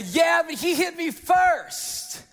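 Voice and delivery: put-on high, drawn-out kid protest; indignant child. Boy excuses punching his brother, mock indignant child. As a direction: play a boy defending himself to mom.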